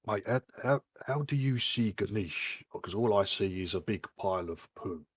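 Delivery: hushed aside, softly voiced; stage whisper. Player murmuring to the Dragonborn behind his hand, hushed aside. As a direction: lean in and murmur it discreetly. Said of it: "I whisper behind my hand".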